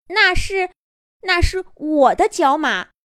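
high, trembling and timid. A narrator's reading. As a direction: quaver it out, scared but brave. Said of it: "颤抖着声音说着".

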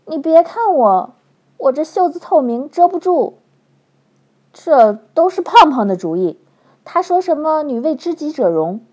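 high, loud fretting voice; flustered flirt. A narrator's reading. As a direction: squeal in embarrassment. She frets, embarrassed.

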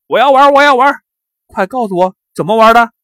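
loud, high-pitched demanding shout; clamor like a kid desperate to play; shouted childish insistence, high and loud; excited clamoring. A narrator's reading. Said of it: "就大声嚷嚷起来".

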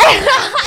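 loud, high laugh; high burst of laughter. Real, not acted. Laughing at her own story, high and loud.

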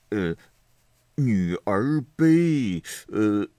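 agonisingly drawn-out, stuck for words; flustered stalling. A narrator's reading. Xue Pan bug-eyed over the next line, flustered stalling. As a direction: clear the throat, then drag the words out. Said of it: "登时急得眼睛铃铛一般".

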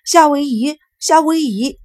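put-on high, loud excited little-boy voice; jumping-up excitement; delighted child. A narrator's reading. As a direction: call it out in high-pitched glee. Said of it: "聪智高兴地从椅子上跳了起来".

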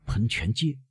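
stifled voice. A narrator's reading. Prosecutor startled by the count's address, stifled and soft. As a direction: choke the words back, softly. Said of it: "维勒夫用憋住的声音说".

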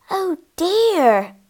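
sharp gasp, then high dismay; shocked gasp. Acted. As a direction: gasp and cry out, pitched high. Shocked on hearing of a murder.

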